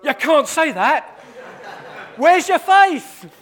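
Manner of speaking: hammed-up shocked friend; alarmed protest. Imagined fellow prisoner objecting to his friend, alarmed. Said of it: "I'd be saying".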